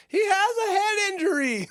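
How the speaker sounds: high, put-on panicked wife voice; wife yelling. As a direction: shriek it like the worried wife. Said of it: "his wife yelled".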